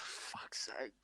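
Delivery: exasperated whisper under the breath; whispered curse. Retold or imitated. Annoyed at his mate late at night, whispered curse. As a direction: hiss it to yourself, fed up. Muttering about noisy mate at midnight.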